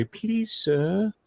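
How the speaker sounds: high, timid begging voice; meek supplicant; mock pleading peasant. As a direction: grovel to the sheriff for a gun. Begging the sheriff for permission.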